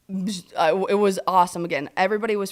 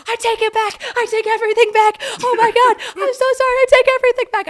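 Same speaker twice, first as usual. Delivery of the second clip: very high, loud frantic squeal; screaming, crying; 8 dB louder, faster than usual. Retold or imitated. Fan re-enacting her video of the goal, screaming and crying. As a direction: shriek a tearful apology, re-enacting it. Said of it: "I'm screaming, I'm crying".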